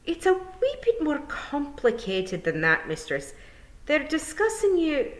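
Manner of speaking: high, slow, uneasy Scots pixie; reluctant to say it; squeaky shy Feegle; embarrassed young pixie. Acted.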